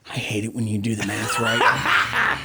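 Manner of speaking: mock annoyed through laughter; laughing complaint. Real, not acted. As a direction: grumble it while laughing.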